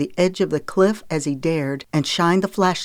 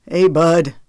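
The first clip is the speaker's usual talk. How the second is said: casual call, a bit louder; conversational call; 6 dB louder than usual. A narrator's reading. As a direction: call down to a boy, keeping it easy. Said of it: "called down in a conversational tone".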